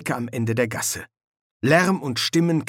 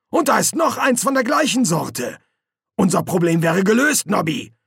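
higher-pitched, pleased sergeant voice; eager sergeant; 8 semitones higher, 4 dB louder than usual. Acted. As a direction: show off the lucky find to your corporal. Colon finds second costume.

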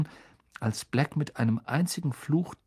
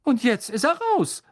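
higher, louder ominous gossip; Stan; 10 semitones higher, 6 dB louder than usual. Acted. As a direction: savour the danger.

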